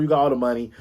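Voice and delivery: normal talking voice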